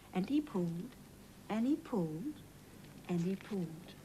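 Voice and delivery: quiet, effortful and he pulled; hushed straining narration; soft strained repeat. A narrator's reading. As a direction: whisper the effort.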